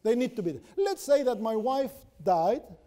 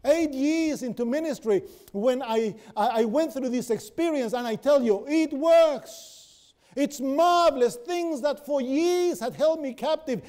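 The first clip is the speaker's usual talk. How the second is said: louder, rising sermon climax; full-force preaching; 5 semitones higher, 4 dB louder than usual. Acted. Preacher urges friends toward resurrection life, loud fervent preaching. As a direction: preach it out with fire and conviction.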